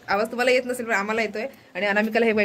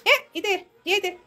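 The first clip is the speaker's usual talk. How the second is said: much higher scolding calls; high scolding to the bird, half laughing; scolding the pet; 9 semitones higher than usual. Real, not acted. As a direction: tell the parrot off, high.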